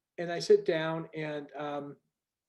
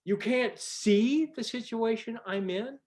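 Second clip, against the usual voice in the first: slow, higher, incredulous inner voice; higher and slow, stunned you-can't-be-serious; exasperated disbelief; 7 semitones higher, slower than usual. Retold or imitated. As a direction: drag out the disbelief at their blindness. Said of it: "And you think".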